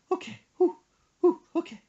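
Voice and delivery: high breathy panting whews; mom calming herself. Retold or imitated. Son imitating mom pulling herself together, high falsetto. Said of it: "gets herself together".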